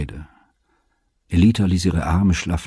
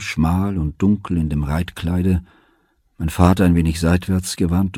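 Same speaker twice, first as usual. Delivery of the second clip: usual speaking voice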